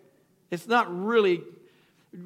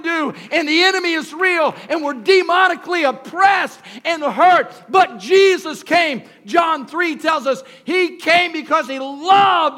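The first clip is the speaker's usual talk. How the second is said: preaching at full force; 5 semitones higher, 6 dB louder than usual. Acted. Pastor driving home why Jesus came, loud and high. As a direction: build it to a ringing climax. Sermon climbs to a climax.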